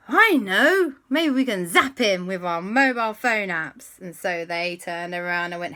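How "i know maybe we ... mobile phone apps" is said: high, loud gasp of a bright idea; excited idea. Acted. The children thinking of zapping the ghost, excited gasp. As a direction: burst out with the plan.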